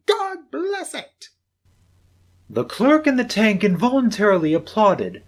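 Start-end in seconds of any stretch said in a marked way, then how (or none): 0.1-1.3 slow, high, drawn-out cry; fervent blessing. Acted. Nephew crowning his speech to Scrooge, slow and fervent. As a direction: lift it up like a toast.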